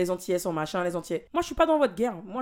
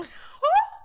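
burst of laughter; 3 dB louder than usual. Real, not acted. Reacts to the story's end.

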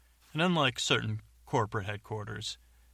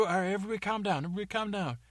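high, fast, pleading calm down; 10 semitones higher, much faster than usual. Acted. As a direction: hush the crowd in a high voice. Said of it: "You go".

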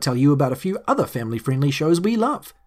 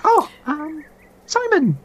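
much higher, excited townswoman voice; high mayor voice; 14 semitones higher than usual. Acted.

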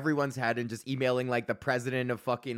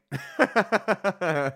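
quick burst of higher-pitched laughter; amused laugh; 6 semitones higher, 4 dB louder than usual. Real, not acted. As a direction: break into a laugh mid-story. Laughs at her own cart story.

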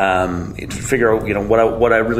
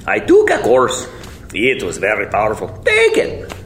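put-on Polish accent. Imitating a Polish business partner in a self-admitted bad Polish accent. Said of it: "imagine a Polish accent".

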